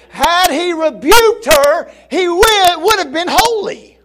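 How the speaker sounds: loud, high, stretched preaching cry; preaching at full force. Acted. Preacher crying out to the congregation, loud and high.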